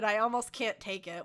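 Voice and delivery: ordinary delivery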